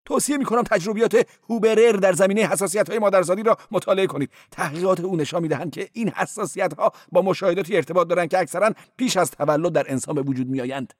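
high-voiced cleric. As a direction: play the cleric high and quick. Cleric lecturing on phone.

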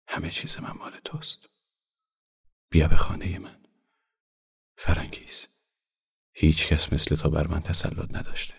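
halting, half-voiced love confession; bashful murmur. A narrator's reading. Shy man confessing his love to the woman, murmured under his breath. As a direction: murmur it shyly, with long pauses between the words.

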